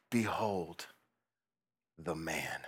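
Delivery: soft, low and slow; hushed Pilate. Retold or imitated. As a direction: let it drop into a hush. Pilate presenting Jesus.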